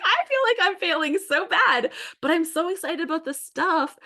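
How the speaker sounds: bubbling over with excitement; excited gush. Retold or imitated. Her excited reply.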